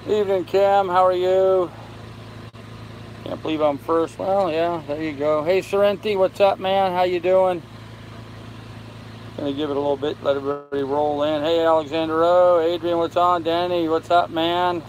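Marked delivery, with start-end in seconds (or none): none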